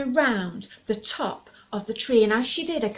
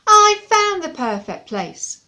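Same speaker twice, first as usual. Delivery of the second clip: loud, slow, much higher dragon boast; stubborn dragon; 9 semitones higher, 11 dB louder than usual. Acted. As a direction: boom it out possessively. Dragon insists.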